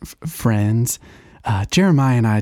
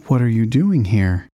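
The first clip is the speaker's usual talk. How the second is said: normal talking voice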